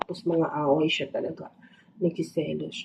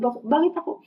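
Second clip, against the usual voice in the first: indignant cat; 7 semitones higher than usual. Retold or imitated. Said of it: "Sabi niya, sabi niya siguro".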